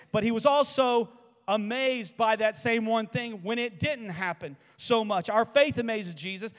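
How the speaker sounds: high, loud preaching; full-force preaching. Acted. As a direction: preach it out to the room.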